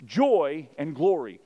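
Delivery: loud, high, driving sermon delivery; full-force preaching. Acted. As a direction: preach it out to the whole room.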